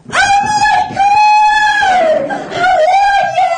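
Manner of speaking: shrieking high squeal, stretched out slowly; Long Island sophomore. Retold or imitated. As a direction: scream with delight at seeing a friend. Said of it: "This is my impression of two Boston University".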